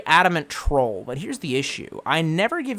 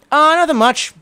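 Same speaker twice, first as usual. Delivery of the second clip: high, loud comic answer; exaggerated casual reply; 10 semitones higher, 6 dB louder than usual. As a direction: sing out the reply far above usual voice.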